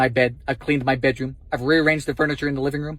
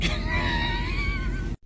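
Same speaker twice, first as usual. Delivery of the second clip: shrieking laugh; 7 dB quieter than usual. Real, not acted. Shocked at frozen windshield.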